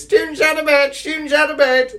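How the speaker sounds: high, loud tattling shout; Peeves shouting. Retold or imitated. Peeves alerting Filch to the students, high shout. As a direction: yell it to wake the whole castle. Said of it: "he shouts and goes".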